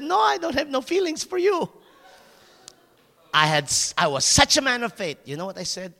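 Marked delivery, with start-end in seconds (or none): 0.0-1.7 high, firm mock of her no; flat refusal. Girl he courted rejecting his proposal, firm refusal. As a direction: turn him down flat, voiced high. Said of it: "With a very clear no".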